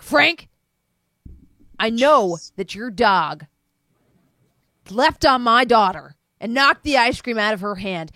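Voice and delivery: loud, deliberate scolding; stern accusation. Acted. Greg lecturing Frank about the unchained dog, stern.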